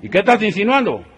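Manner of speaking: high, loud suspicious question; suspicious outburst. Acted. Cellmate reacts to the Chinese prisoner, high and loud. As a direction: demand it suspiciously.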